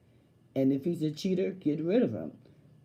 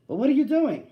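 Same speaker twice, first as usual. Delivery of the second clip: high, loud demanding question; jealous demand, pitched up and loud; suspicious boyfriend; 9 semitones higher, 8 dB louder than usual. Retold or imitated. Boyfriend checking up on her.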